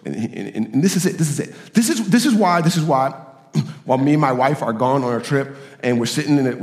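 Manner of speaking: usual speaking voice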